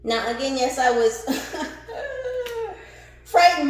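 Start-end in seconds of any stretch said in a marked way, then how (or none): 1.3-2.8 high laugh. Real, not acted. Laughing about being frightened.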